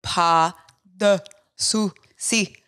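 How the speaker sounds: "Pas de souci" broken into separate syllables, very slow. Shown as a demonstration. What she needs French people to do, "Pas de souci" slowly. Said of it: "Like, slow".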